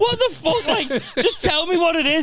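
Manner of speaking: very high, laughing protest; high-pitched mock exasperation; exasperated. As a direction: plead in comic disbelief. Acts exasperated reply to father.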